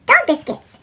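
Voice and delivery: usual speaking voice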